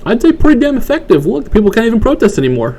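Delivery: very high, squeaky mock praise; sarcastic approval. Mocking how effective the gun buyback was, high sarcastic approval. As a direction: pitch it way up in fake approval. Sarcastic answer to own question.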